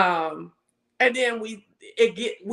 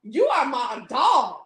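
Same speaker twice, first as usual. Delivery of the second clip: retold confrontation; 6 semitones higher than usual. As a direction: press him hard. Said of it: "And I said".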